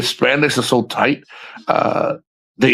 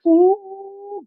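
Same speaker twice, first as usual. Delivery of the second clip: high, drawn-out "ooh"; playful high whoop; excited falsetto ooh. Pretend. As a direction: let out a high-pitched ooh of delight. Excited over imagined housekeeper.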